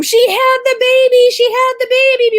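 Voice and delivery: very high, loud cry of joyful news; imitated elated announcement. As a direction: burst in shouting as the overjoyed husband, easing at the end. Husband runs in announcing the birth.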